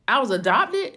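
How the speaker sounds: stunned, can't believe it; shocked disbelief. Retold or imitated. Daughter hearing she was adopted, shocked.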